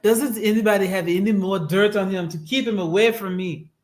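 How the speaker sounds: a lightly put-on Eastern European accent, deliberately clumsy; wobbly mock Eastern European accent, half-hearted; bad Melania accent. Wife hoping to avoid her husband, in a weak Eastern European accent. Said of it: "That's the worst accent ever".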